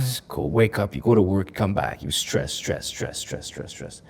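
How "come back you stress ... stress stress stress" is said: each stress softer, trailing off to a whisper; worn-down repetition that dies out; fading repetition. Retold or imitated. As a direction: let the repeated word fade away slowly. Exhausting daily routine.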